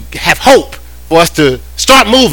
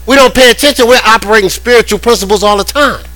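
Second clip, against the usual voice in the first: full-force preaching; 8 semitones higher than usual. Acted. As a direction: preach it up high to the congregation.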